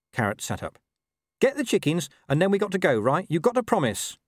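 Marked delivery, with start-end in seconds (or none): 1.4-4.2 high, pushy dog voice; insistent talking dog. Acted. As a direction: badger him in a high-pitched voice. Gaspode pressing Carrot for promise.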